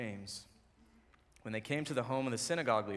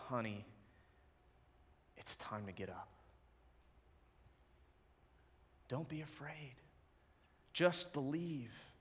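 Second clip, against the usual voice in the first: tender waking; 8 dB quieter than usual. Acted. Jesus gently waking the little girl, tender and soft.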